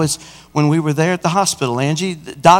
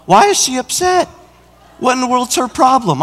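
high-pitched, exasperated disbelief; acted-out baffled protest; upset bafflement; 8 semitones higher than usual. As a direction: retell your indignant puzzlement, pitched way up. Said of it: "I was actually upset".

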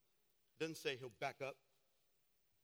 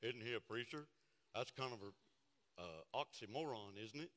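skeptical listener; 3 semitones lower than usual. Retold or imitated. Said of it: "You're like".

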